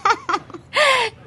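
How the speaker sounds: high burst of laughter; surprised laugh. Acted. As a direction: laugh it off, amused. Waitress laughs at question.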